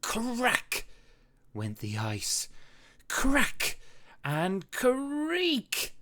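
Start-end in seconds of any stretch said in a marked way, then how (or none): 0.0-0.9 sharp high crack of ice; sound effect. A narrator's reading. Narrator voicing the ice cracking, sharp and high. 3.1-5.9 high, crisp crack and creak; sound effect. A narrator's reading. Narrator voicing ice splitting, crisp and high.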